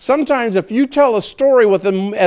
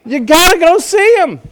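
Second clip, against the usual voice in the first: higher and louder eager call; excited urging; 9 semitones higher, 6 dB louder than usual. Retold or imitated. The woman urging the town to see Jesus, excitedly. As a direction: urge them to come, thrilled. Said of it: "And so they got excited".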